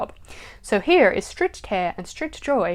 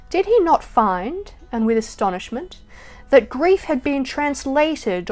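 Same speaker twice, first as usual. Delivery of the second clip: emphatic, measured poetry reading; poem recital; 3 semitones higher, 6 dB louder than usual. Acted. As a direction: declaim the verse with rolling emphasis, louder than chat. Recites James Stephens poem.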